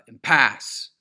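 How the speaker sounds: impasse with a loud, drawn-out second syllable; im-PASSE, slammed on the end; wrong stress on PASSE. Shown as a demonstration. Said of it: "Those are obviously all the wrong".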